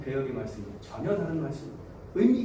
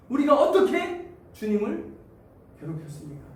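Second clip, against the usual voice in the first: high, loud rising challenge; indignant question; 7 semitones higher, 3 dB louder than usual. Acted. Israelites questioning God, high indignant challenge. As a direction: demand an answer. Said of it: "이렇게 따집니다".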